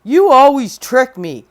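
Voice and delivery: loud giant voice; a big voice, grumbling; sulky giant. Acted. Giant complaining to Jack, loud and grumpy. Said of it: "says the giant".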